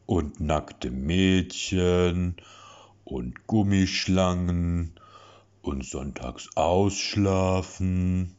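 put-on slow, drawn-out babyish list; cheerful singsong. A narrator's reading. Lasse listing things he likes, slow babyish singsong. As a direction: croon each item happily.

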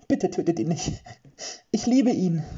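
high, loud pleading with breaking voice; desperate pleading. Acted. Ludmilla begs for her lover's life, voice breaking. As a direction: beg for his life through breaking voice. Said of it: "Ludmillas Stimme überschlug sich".

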